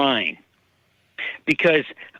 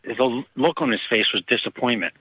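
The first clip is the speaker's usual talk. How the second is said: ordinary talk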